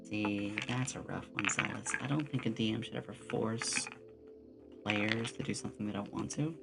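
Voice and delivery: ordinary talk, nothing marked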